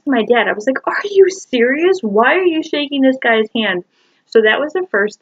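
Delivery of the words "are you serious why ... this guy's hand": much higher, incredulous retold protest; outraged disbelief. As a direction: question dad in appalled disbelief, pitch soaring. Retold outrage at dad's handshake.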